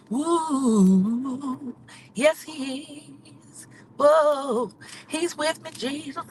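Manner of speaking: high, joyful shouts of praise; excited praise. Real, not acted. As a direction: whoop it up for the Lord. Praising the Lord while driving.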